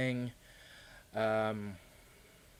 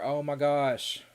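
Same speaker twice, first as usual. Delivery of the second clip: surprised; 5 semitones higher, 8 dB louder, much slower than usual. Real, not acted. Reacting to viewer never trying ice cream.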